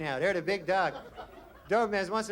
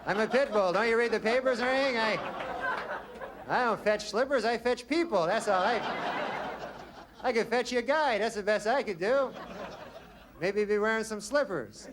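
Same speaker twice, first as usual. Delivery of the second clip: tough-guy dog; 4 semitones higher than usual. Retold or imitated. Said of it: "Pitbull go".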